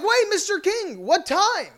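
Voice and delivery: high, urgent question; eager call. Retold or imitated. Fan asking Larry King the time, high urgent call. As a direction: call after him urgently. Calling after him.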